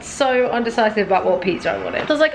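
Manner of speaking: usual speaking voice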